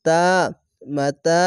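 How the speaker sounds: normal talking voice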